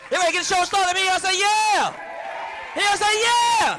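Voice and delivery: high, loud emcee call-out; host hype shout. Real, not acted. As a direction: pump up the crowd, introduce the act. Host hyping crowd, introducing comic.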